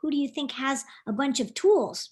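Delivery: plain reading voice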